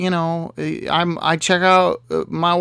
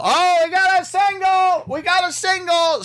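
mock yell. Park attendants announcing a lone rider to everyone, mock yell. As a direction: imitate a yell carrying down the line. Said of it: "generally they yell".

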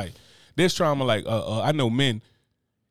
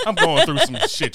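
put-on high, slow, louder whiny child voice; mock whine; 6 dB louder than usual. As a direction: whine it like a hurt kid.

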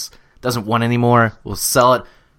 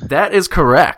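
announcer shout; 6 semitones higher, 6 dB louder than usual. Acted. Quizmaster announcing.

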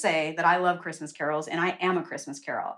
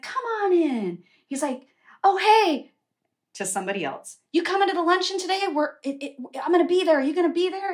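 high, bubbly host voice; mock overly cheerful doctor; cheery doctor; 10 semitones higher, 4 dB louder than usual. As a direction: gush like a party host.

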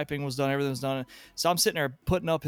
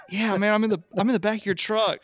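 half-laughing; 8 semitones higher, 5 dB louder than usual. Retold or imitated. Retelling call from truck bed.

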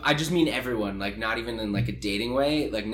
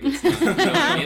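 high, loud burst of laughter; laughing; 7 dB louder than usual. Real, not acted. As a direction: laugh through the words. Laughing at her own line.

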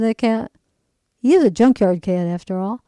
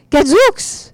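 high, loud cry of amazement; excited exclamation; 8 semitones higher, 7 dB louder than usual. Acted. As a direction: shout it out, thrilled by the find. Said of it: "exclaimed his father".

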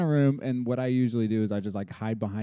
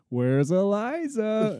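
playful seeker call; 8 semitones higher, 5 dB louder, much slower than usual. Retold or imitated. Dad calling in hide and seek.

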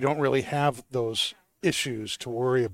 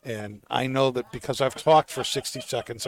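ordinary talk

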